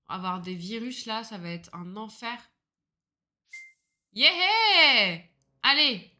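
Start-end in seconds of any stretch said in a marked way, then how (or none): 4.1-6.2 a high, loud cheer of yeah; excited yeah. Real, not acted. Streamer kicking off her live, loud cheer. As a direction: throw out a big yeah as the stream starts.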